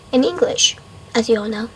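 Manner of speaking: ordinary delivery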